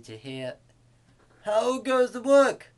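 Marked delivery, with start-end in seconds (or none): 1.4-2.7 high, loud farmer's holler; farmer calling out. A narrator's reading. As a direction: call it out loudly across the farmyard. Farmer's call the duck expects.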